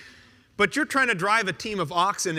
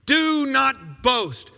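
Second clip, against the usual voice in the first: slow solemn command; 5 semitones higher, 4 dB louder, much slower than usual. Retold or imitated. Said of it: "To the boastful".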